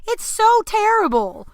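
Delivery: high, loud cry of disgust; outraged. Real, not acted. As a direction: explode in exasperated outrage. Climax of angry rant.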